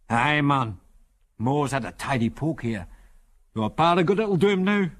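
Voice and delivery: put-on old Scotsman, broad Scots accent; broad Scots character voice; strong Scots accent. A narrator's reading. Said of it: "in a strong Scots accent".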